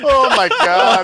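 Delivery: slow, laughing disbelief. Real, not acted. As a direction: drag it out through laughter. Reacting to the story.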